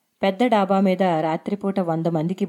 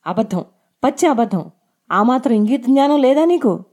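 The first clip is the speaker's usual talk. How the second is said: higher-pitched, heated rebuke; angry; 5 semitones higher, 4 dB louder than usual. A narrator's reading. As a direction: throw the lie back at him angrily. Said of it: "అంది ఎర్రని కళ్ళతో". (కోపంగా నిలదీస్తూ)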